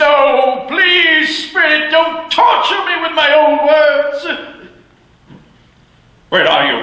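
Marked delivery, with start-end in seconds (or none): 0.0-4.2 high, desperate cry; anguished higher plea; pleading. Acted. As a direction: beg the spirit in anguish. Scrooge begging the spirit.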